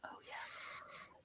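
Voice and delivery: breathy conspiratorial whisper. Retold or imitated. The nurse whispers a confidential aside to the patient. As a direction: let her in on a secret, voiceless.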